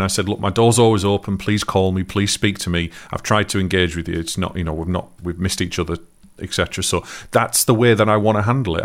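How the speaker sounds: ordinary delivery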